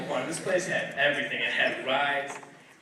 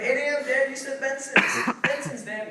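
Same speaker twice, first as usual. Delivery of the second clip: put-on high pleading kids; 8 semitones higher than usual.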